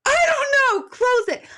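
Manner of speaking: high, loud mock panic; retold panicked scream. Retelling her fright at the crawl space door, mock panicked scream. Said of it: "I started to scream".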